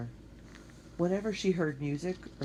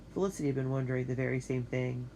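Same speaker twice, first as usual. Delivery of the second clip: ordinary delivery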